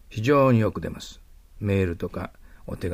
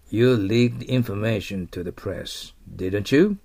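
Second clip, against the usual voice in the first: slow model reading; 4 dB louder, much slower than usual. Shown as a demonstration. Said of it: "こういう感じです。".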